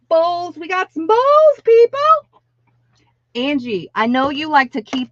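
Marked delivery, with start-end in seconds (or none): 0.1-2.0 high, loud, eager call to viewers; excited announcement. Real, not acted. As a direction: shout it out like a sales pitch. Announcing bowls to live viewers.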